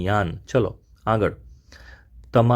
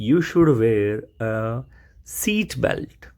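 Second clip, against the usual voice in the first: slow teaching read; much slower than usual. Shown as a demonstration. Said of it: "teacher models the sentence".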